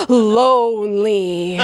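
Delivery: Lonely drawn out slow, like a word in lights; sparkling emphasis. Acted. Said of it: "like it was in lights with sparkles".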